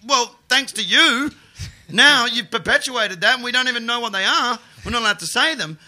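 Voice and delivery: indignant retort. Retold or imitated. Protesting to the breakfast show hosts, loud and high. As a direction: shout back in disbelief. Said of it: "And I'm like".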